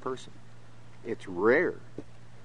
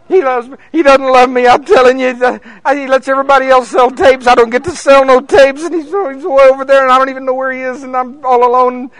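high, loud petulant whine; preacher mocks his own sulking at God; mock whining; 12 semitones higher, 14 dB louder than usual. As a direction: pout like a sulking child. Mock petulant self-pity.

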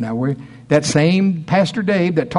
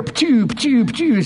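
high spray sound; 9 semitones higher than usual. Retold or imitated. Imitating wife spraying.

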